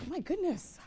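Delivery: high startled inner voice; startled thought, voiced high; surprised. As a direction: gasp it out at the sight. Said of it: "And I think".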